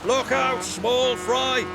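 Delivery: high loud booming taunt; booming tease. A narrator's reading. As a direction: boom a teasing warning. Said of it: "boomed Max".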